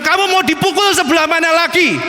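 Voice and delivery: high-pitched, indignant divine rebuke; God rebuking. Retold or imitated. Preacher voicing God to the unrepentant artist, high and indignant. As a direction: voice God's exasperated challenge, pitched high. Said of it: "Itu Tuhan akan bilang".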